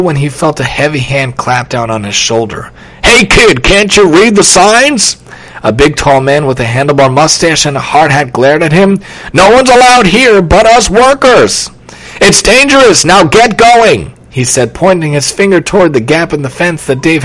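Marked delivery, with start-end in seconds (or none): 3.0-5.2 raised-pitch, drawn-out reprimand; scolding construction worker. A narrator's reading. Worker scolds trespassing boy. 9.3-14.1 high, forceful telling-off; stern construction worker. A narrator's reading. Worker orders boy out.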